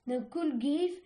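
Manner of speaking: ordinary delivery, nothing marked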